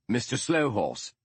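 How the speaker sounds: called out high, to be heard across the exit; raising the voice after a man walking away; calling out. Acted. Holmes calls after the departing Indian, high and carrying.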